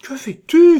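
very high, loud shriek of alarm; panicked high-pitched outburst; alarmed cry. A narrator's reading. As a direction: cry out to stop someone. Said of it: "s'écria Gervaise".

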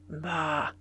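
low, soft, untroubled "bah"; calme paisible. A narrator's reading. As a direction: brush it off gently, low and quiet. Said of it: "dit le père de sa voix paisible". (voix paisible et rassurante)

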